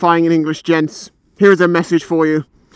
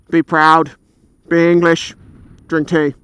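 normal talking voice

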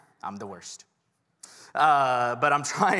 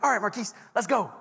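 excited call; 8 semitones higher, 3 dB louder than usual. Retold or imitated. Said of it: "And I'm like".

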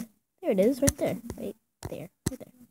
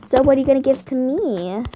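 usual speaking voice

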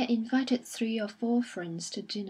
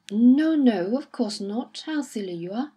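much louder, brusque no no; loud reassurance; 2 semitones higher, 10 dB louder than usual. A narrator's reading. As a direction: wave her fear away with bluster. Colonel brushing off her fear.

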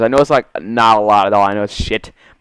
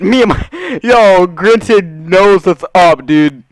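hyped gamer bro; 8 semitones higher, 6 dB louder, much slower than usual. Real, not acted. Hyped laughing shout.

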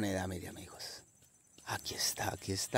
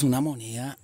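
ordinary delivery

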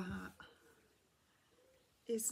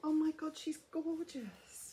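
delighted gasp; 8 semitones higher, 5 dB louder than usual. Real, not acted. Gushing over the toy cow.

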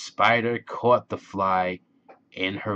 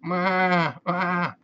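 high drawn-out goat bleat; 9 semitones higher than usual. A narrator's reading. Goat calling to the spider in a story, bleating. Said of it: "said the goat".

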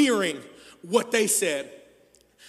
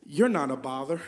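tender; 4 semitones lower than usual. Acted. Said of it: "Jesus steps in and says".

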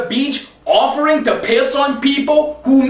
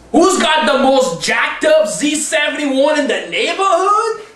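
ordinary talk, nothing marked

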